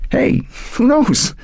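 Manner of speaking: high, drawn-out hopeful lift; brightening. A narrator's reading. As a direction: son cheering up about grandma's return, brightening. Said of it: "he brightened".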